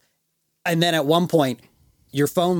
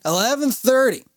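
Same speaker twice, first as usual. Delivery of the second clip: put-on drunken correction; 10 semitones higher, 3 dB louder than usual.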